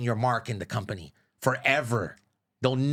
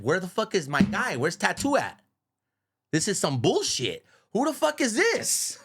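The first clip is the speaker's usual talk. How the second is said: higher, half-laughing indignant boss; mock outrage; 7 semitones higher than usual. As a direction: play the puzzled, annoyed boss. Oscar storming in, retold.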